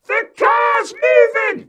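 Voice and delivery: put-on very high, loud child's scream. A narrator's reading. Joe to dad as car rolls away, screaming. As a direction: scream the alarm, stretching the words. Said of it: "He's screaming".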